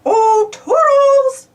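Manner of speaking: long, high, loud call. Acted. Mickey calling for Toodles, long high loud call. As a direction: sing out the name slowly. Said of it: "said Mickey".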